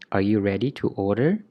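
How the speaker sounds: ordinary talk, nothing marked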